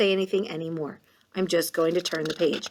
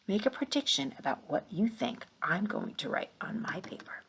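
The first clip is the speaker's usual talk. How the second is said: a secretive whispered prompt; 2 semitones higher, 8 dB quieter than usual. Shown as a demonstration. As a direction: lean in and whisper the question to the children.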